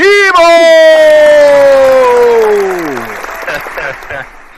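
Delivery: big burst of laughter; loud laugh. Real, not acted. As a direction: howl with laughter. Reacting to grand entrance.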